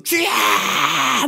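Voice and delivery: long, wailing cry of Lord. Retold or imitated. 금식기도원에서 주님께 울부짖는 긴 외침. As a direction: stretch the call out as if crying to God. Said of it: "있는 소리 없는 소리 지르면서".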